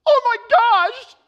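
high, loud, gushing woman; excited lady. Retold or imitated. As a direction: squeal it out, delighted. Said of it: "and she goes".

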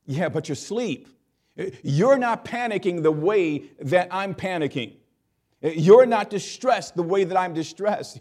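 a frantic disciple pleading, building to a cry; panicked disciple. Acted.

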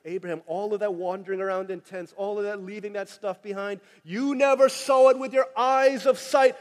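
usual speaking voice

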